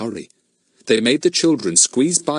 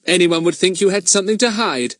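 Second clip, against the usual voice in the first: higher, louder, impatient challenge; Fatty losing patience with the men; impatient boy; 10 semitones higher, 4 dB louder than usual. A narrator's reading. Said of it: "said Fatty impatiently".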